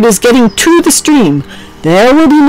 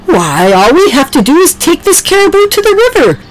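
high, excited animal voice; bursting with a bright idea; 11 semitones higher than usual. A narrator's reading. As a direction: Oliver sharing his plan with the barn. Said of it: "Oliver said excitedly".

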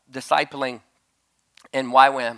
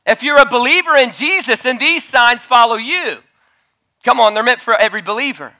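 loud, high preacher's call to the crowd; preaching at full force; 8 semitones higher, 9 dB louder than usual. Acted. Preacher rallying the believers, loud and high.

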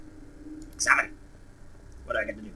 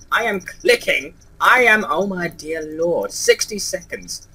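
frustrated; 4 semitones higher, 6 dB louder, slower than usual. Real, not acted. Frustrated with controls.

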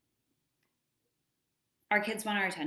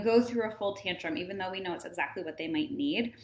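ordinary talk

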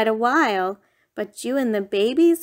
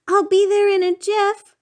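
high loud reassuring call; yelling; 10 semitones higher, 5 dB louder than usual. Acted. Parent yelling to babies stuck in tree, loud high call. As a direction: shout it while hurrying down. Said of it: "You yelled".

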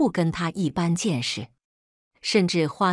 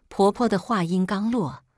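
usual speaking voice

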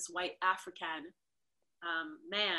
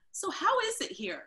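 naive question; 12 semitones higher, 6 dB louder than usual. Retold or imitated. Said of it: "I was like".

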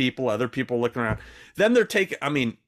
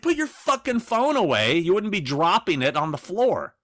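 mock angry snap; 8 semitones higher than usual. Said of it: "So she's like".

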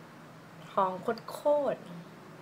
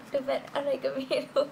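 laughing; 6 semitones higher than usual. Real, not acted. Laughing at her fierce look.

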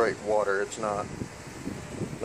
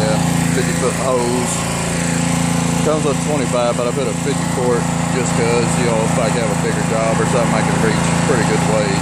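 raised voice over a loud engine; talking over noise; 4 dB louder than usual. Real, not acted. Showing viewers the washer running, loud raised voice. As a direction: project over the roaring pressure washer.

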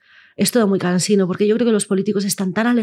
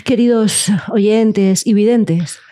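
louder, slow pompous address; mock formal speech; 3 dB louder, slower than usual. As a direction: deliver it like a grand farewell speech. Mock formal podcast farewell.

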